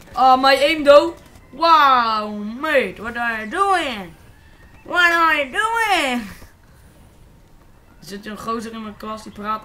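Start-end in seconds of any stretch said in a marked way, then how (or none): 0.1-1.1 much louder, drawn-out frustrated yell; loud slow cry. Real, not acted. Gamer yelling at his own bad aim, long and loud. 1.5-6.2 loud, drawn-out mock English outburst; exasperated yell. Real, not acted. Gamer yelling at a useless teammate, slow and loud.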